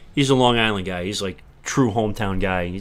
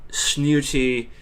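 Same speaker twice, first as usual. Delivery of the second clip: louder, higher snooty British accent; 4 semitones higher, 5 dB louder than usual. Shown as a demonstration. Streamer lists British accents for a viewer, snooty British.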